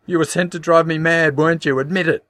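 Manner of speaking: high, loud furious scream. A narrator's reading. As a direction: scream it in rage. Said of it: "she screamed".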